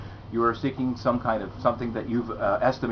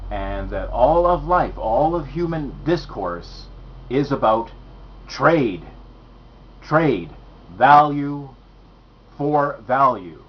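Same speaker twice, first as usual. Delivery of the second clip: slow, louder, weighty emphasis on each phrase; emphatic; 3 semitones higher, 6 dB louder, slower than usual. Real, not acted. Lecturer driving home his core idea, slow and louder emphasis. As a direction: hammer home the key point word by word. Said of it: "Let me just emphasize that".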